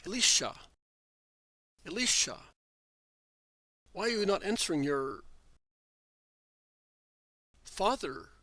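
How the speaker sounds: a father calling his son a second time. A narrator's reading. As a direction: call loudly toward the cave. Father calling son again at cave.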